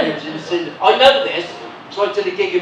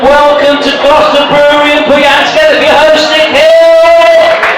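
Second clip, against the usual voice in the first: loud, high MC introduction; show announcer; 7 semitones higher, 10 dB louder than usual. Acted. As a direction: whip up the crowd for the host, loudly. Introduces the host to the crowd.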